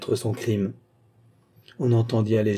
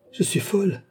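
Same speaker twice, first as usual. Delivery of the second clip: distraught cry; 9 semitones higher than usual. Acted. Confessor realises what she said.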